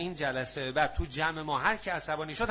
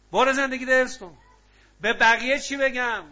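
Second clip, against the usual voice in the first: raised retold dialogue; 8 semitones higher, 9 dB louder than usual. Retold quarrel between counsellor and wife.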